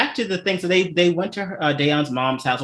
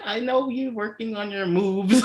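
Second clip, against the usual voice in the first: a light, higher mom voice; imitating my mom; 7 semitones higher than usual. Mom seeing my dance covers, lightly imitated and higher. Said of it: "my mom when she's like".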